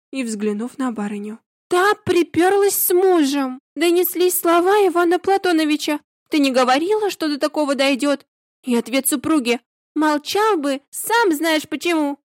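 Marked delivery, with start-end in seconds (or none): 1.7-12.2 overheard quarrel. A narrator's reading.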